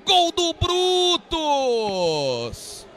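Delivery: long stretched goal scream; explosive goal commentary. Acted. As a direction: roar the goal call, drawing out gol. Narrator calls a goal.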